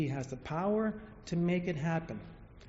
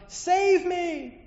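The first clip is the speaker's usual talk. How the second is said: high, loud, desperate yell; 12 semitones higher, 8 dB louder than usual. Acted. Hanging man yells to God, desperate and loud. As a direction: shout for rescue. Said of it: "The man yells out".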